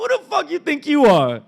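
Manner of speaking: high, loud scornful retort; indignant sister. Retold or imitated. Sister snapping back at her brother, indignant. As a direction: fire back loudly in a high voice. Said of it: "This is what my sister said to me".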